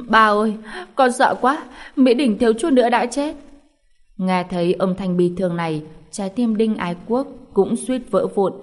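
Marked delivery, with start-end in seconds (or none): 0.1-3.4 hammed-up whimper, higher and louder; fake crying. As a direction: play a spoiled girl faking tears to her father. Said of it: "làm bộ khóc lên". (giả vờ mếu máo, cao và to hơn)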